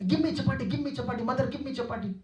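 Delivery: high, repeated calling for chapati; repeated begging call. Retold or imitated. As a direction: call to mother again and again like a child. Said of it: "Two, three times he call like that".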